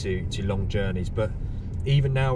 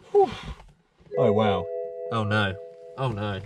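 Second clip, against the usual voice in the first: usual speaking voice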